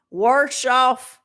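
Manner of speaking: warsh off in a mock drawl; put-on regional accent. Shown as a demonstration. Trying out a warsh accent on her glasses. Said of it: "That ain't my accent".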